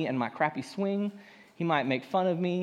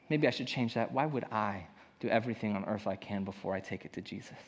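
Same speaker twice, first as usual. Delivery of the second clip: quieter self-address; 6 semitones lower, 7 dB quieter than usual. Real, not acted. Rephrases to himself, quieter.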